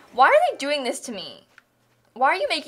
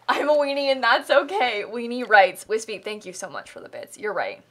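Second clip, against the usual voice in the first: ordinary talk, nothing marked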